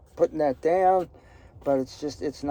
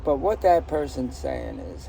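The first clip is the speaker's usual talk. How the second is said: ordinary delivery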